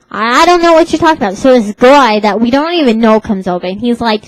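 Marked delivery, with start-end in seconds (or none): 0.1-1.4 fast, loud flustered clerk. Retold or imitated. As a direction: rattle it off like a confused cashier. Clerk's retort, voiced.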